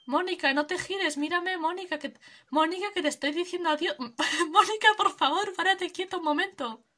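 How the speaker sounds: half-laughing plea for her to look; calling out. Real, not acted. Streamer begging Mónica to stop and look, calling out. Calling a villager who won't turn.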